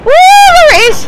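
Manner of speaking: high, loud cry of triumph; triumphant shout. Real, not acted. Player spots the target, shouts in triumph. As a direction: yell it like you've won.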